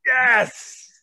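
loud, high cheer of joy; real delighted yell; thrilled shout. Real, not acted. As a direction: cheer like your team just scored. Thrilled Mad Hatter is in.

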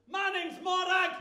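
high, thin woman's voice; put-on high feminine voice; Morag. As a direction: squeak it as a drag alter ego. Drag alter ego introduces herself.

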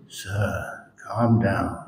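a serene monk quieting an eager visitor; calm soothing. Retold or imitated. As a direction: hush the man gently. Monk calms the excited man.

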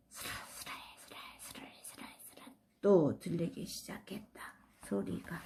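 a faint unvoiced whisper. Boy whispering as the candy's strange sound begins, hushed. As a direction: breathe the words out with no voice.

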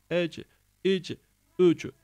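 "Ec, ic, üc" with an echo bouncing off each C; qalqalah echo. Shown as a demonstration. Teacher drilling students, echoed endings on "Ec, ic, üc". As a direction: release each final j-sound into a short vowel. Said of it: "Bunda kalkale vardır arkadaşlar".

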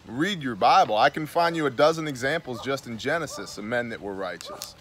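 ordinary talk

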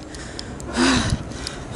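long unvoiced exhale; breathless sigh. Real, not acted. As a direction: huff out after running. After running to catch train.

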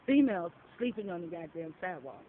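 ordinary delivery

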